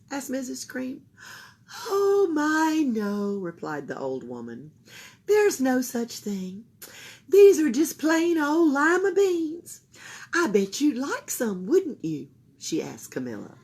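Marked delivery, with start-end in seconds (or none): 1.9-12.3 put-on old woman voice. A narrator's reading.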